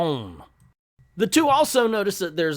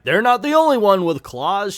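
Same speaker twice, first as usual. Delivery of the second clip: loud, raised fighting quip; mock battle boast; 7 semitones higher, 9 dB louder than usual. Wolverine quips while fighting crabs, loud mock boast. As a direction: belt it out like a comic-book brawler.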